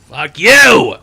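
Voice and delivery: loud, sharp shouted curse; angry shout. Acted. Comedian curses the nosy cashier, angry shout. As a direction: bark it at him.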